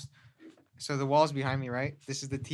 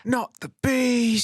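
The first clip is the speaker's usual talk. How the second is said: high, slow, drawn-out mock panic; Nicolas Cage; 9 semitones higher, 3 dB louder, slower than usual. Quoting a film after the comparison, panicked Nicolas Cage impression. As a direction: wail it in hammed-up movie terror. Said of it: "you sound like Nicolas Cage".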